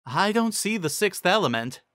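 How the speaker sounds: high, puzzled; high teacher voice. A narrator's reading. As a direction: say it high, searching. Cheerilee voiced.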